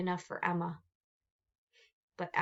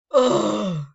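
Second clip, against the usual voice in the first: loud exasperated grunt; disgusted snarl; 12 dB louder than usual. Acted. Cynthia annoyed at being decorated, snarling. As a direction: spit out your disgust. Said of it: "Cynthia snarled".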